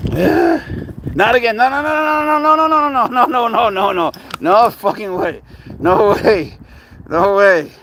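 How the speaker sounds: high loud no after no; terrified refusal riding the slide; panicked screaming. Real, not acted. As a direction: scream it in real dread. Panics as slide starts again.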